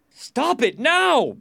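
high, drawn-out angry shout; crowd shouting. A narrator's reading. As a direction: yell like a panicked mob. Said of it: "people were shouting".

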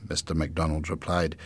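ordinary delivery, nothing marked

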